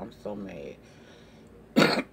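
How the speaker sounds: stretched-out, slow, low I'm so mad; real slow frustration. Real, not acted. Vlogger venting to viewers, very slow drawn-out moan. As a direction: drag each word out in exasperation. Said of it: "and I'm so mad".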